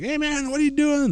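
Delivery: lightly mocking the drunk kids' hey; mock rowdy kids. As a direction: play a tipsy kid hailing a stranger. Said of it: "they're talking, like".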